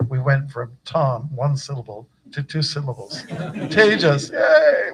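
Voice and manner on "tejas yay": Tejas laughed out, then a much higher, louder yay; excited cheer. Real, not acted. Guru cheering the disciple's new name, excited laughing cheer. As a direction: celebrate the name like a little victory.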